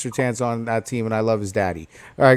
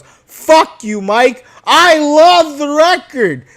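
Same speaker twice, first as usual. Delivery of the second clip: high, loud, slow; angry yell; 11 semitones higher, 7 dB louder, slower than usual. Real, not acted. Fan yelling at coach Mike, angry. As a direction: shout it at him.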